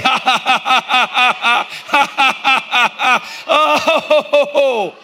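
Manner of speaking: loud, high deliberate ha-ha-ha laugh, put on on purpose; a showy fake laugh to prove joy is a choice; forced laugh. Shown as a demonstration. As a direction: laugh on cue even though nothing is funny. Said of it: "I'm just gonna say".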